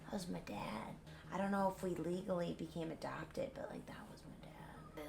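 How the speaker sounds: soft, whispered, broken grief; mock-devastated whisper after collapse; hushed mourning for his dad; grieving whisper. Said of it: "Seymour collapses".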